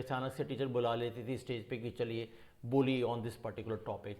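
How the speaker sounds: ordinary talk, nothing marked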